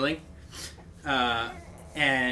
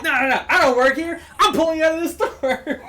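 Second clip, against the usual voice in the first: high and loud, all fired up; fierce yelling; 13 semitones higher, 8 dB louder than usual. Retold or imitated. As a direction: shout him down. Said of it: "And he's like".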